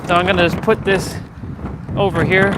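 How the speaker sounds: loud and strained in the cold; gasping in icy wind. Real, not acted. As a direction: groan through the freezing wind. Braving icy wind outdoors.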